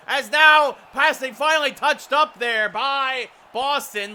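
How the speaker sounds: loud, high, urgent shot call; excited play-by-play. Real, not acted. Announcer calls Kreider's chance saved by Halak, excited shouting.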